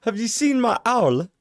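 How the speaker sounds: put-on high squeaky boy; mock Harry. Joining the bad Harry reading, high pitched. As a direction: plead for the owl squeakily. Playing Harry in audiobook bit.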